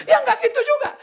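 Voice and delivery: very high, whiny backtrack; mock wife's protest. Wife backtracks when pastor questions her, high mock protest. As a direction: imitate the upset wife in a high voice.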